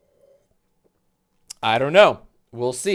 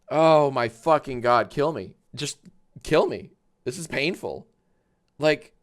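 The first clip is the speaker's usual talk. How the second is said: ordinary talk, nothing marked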